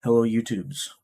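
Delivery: loud, booming greeting to viewers; Robert Oberst. Acted. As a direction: boom it out as a playful impersonation. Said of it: "did my best Robert Oberst impersonation and said".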